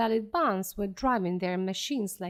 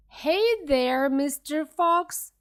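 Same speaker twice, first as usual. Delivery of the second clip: louder, higher jeering call; taunting yell; 5 semitones higher, 5 dB louder, slower than usual. A narrator's reading. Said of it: "yelled Buns".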